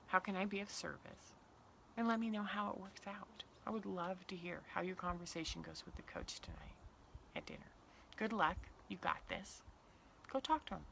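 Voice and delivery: quiet, supportive parent voice, near whisper; soft encouragement. Pretend. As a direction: send them off gently.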